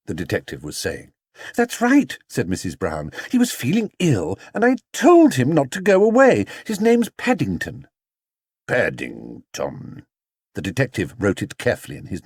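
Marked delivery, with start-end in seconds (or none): 1.6-2.2 higher, lightly voiced mother; a brisk, worried mother answering; Mrs Brown voice. Worried mother answering the detective, higher voice. 3.3-7.9 higher, lightly voiced mother; a brisk, worried mother answering; Mrs Brown voice. Worried mother answering the detective, higher voice. 8.7-10.1 low, slow, deliberate repeat; detective's voice. Acted. Detective noting the name, low and slow.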